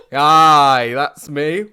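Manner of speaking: put-on holiday rep in a muddled northern-southern accent. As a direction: answer proudly in a mangled northern accent. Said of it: "northern southern accent".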